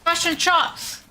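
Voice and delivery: "Fish and chops" with a New Zealand vowel, the i of chips turned to o; mock New Zealand accent. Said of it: "Frick, I can't even say it".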